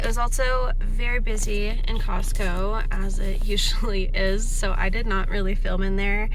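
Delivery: loud talk over roaring wind; windy outdoors. Real, not acted. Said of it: "It is so windy outside".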